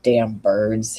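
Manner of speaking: low, mildly grumbled curse; understated grumpy complaint; grumbling. A narrator's reading. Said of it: "he grumbles".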